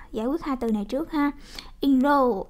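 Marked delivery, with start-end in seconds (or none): 1.8-2.4 "Enroll" with a falling stress on ROLL; second-syllable stress. Shown as a demonstration. Teacher modelling a verb for students, stress on second syllable of "Enroll". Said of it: "động từ nhấn âm hai".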